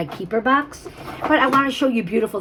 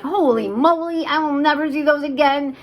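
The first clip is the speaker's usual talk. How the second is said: retold amazement; 8 semitones higher, 4 dB louder than usual. Shopper recalling first sight of rare earrings, amazed. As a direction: imitate being wowed, louder and higher. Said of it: "I'm like".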